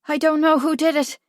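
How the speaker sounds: put-on high, level boy's voice; earnest boy. A narrator's reading. Boy answering the prince, high and earnest.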